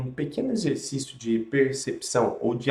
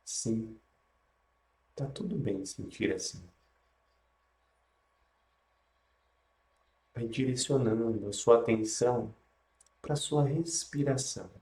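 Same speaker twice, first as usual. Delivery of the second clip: guided meditation; 3 dB quieter than usual. Acted.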